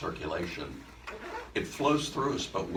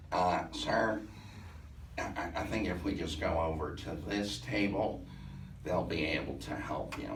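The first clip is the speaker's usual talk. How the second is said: put-on soft, hesitant young woman; imitating her odd halting voice; weird vocal affect; 6 dB quieter than usual. He mimics the millennial's weird halting voice. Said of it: "she has a real weird vocal affect".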